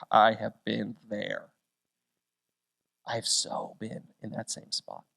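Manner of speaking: whispered, soft and low; hushed confession. Retold or imitated. Preacher admits he felt the same, hushed whisper. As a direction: breathe it like a private admission. Relating to the young pastor.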